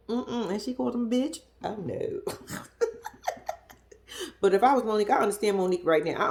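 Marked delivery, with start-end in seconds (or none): 2.3-3.5 soft, high voiceless laughter; quiet burst of real laughing. Real, not acted. Viewer amused by the couple's fight, laughing softly.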